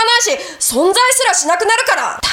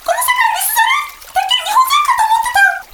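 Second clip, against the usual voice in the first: very high surprised shriek; shocked squeal. Real, not acted. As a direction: squeal out in disbelief. Breaks character, shocked.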